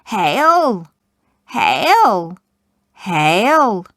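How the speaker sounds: loud, eerie hag cries; witches' hail. Acted. Three witches hail Macbeth out of the mist, eerie and loud. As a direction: call out like three old hags in the mist.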